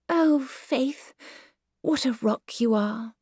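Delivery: lightly voiced fond mother; fond Myrtle voice. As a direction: pat her daughter on the head with words. Myrtle fondly to Faith.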